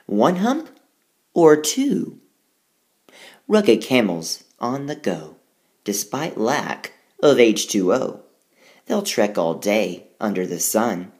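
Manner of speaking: lively, sing-song riddle read aloud; poem reading. Acted. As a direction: read it like a playful rhyme for kids. Reads rhyming poem aloud.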